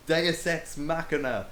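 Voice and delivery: slow loud triumphant proclamation; shouting. Acted. Narrator mocking the plot rescue, slow loud shout. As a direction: announce it grandly.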